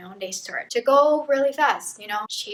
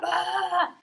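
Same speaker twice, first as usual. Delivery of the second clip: short hammed-up scream; high mock scream; 8 semitones higher than usual. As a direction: squeal in fake fright. Soldiers scared of her.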